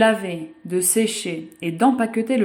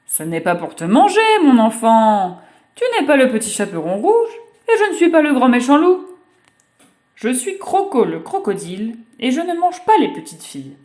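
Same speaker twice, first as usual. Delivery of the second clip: lightly voiced kindly crocodile, a bit louder; 4 semitones higher, 6 dB louder than usual. Crocodile reassuring the girl, kindly and raised. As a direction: reassure the child she is safe.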